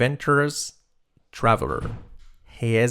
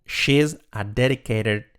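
usual speaking voice

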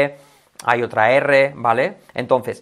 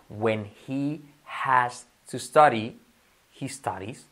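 very slow and soft, "he studies" trailing off; "studies" with a flapped T, slowly; slow drill; 4 dB quieter, much slower than usual. Shown as a demonstration. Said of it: "son todo I". (lento, "studies" como "staris")

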